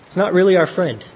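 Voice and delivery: ordinary talk, nothing marked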